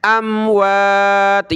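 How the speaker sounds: अमवात stretched very slowly; slow tajweed recitation of अमवात; drawn out. Shown as a demonstration. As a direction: hold each vowel of अमवात long. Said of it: "यहाँ हमने सबीलिल्लाह नहीं पढ़ना".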